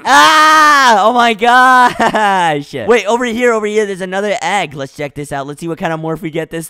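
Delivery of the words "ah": sudden scream. Real, not acted. Sudden fright in game.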